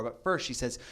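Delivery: ordinary talk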